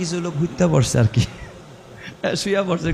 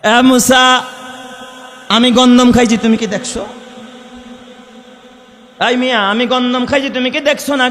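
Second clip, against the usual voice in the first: loud, high angry retort; indignant challenge; 9 semitones higher, 6 dB louder than usual. Acted. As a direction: fire back at the accuser. Adam challenges Musa in argument. (রাগী গলায় মুসাকে পাল্টা প্রশ্ন)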